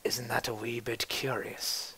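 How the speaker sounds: slow, hushed, menacing question; soft slow threat; quiet menace. Acted. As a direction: lean in and let it hang.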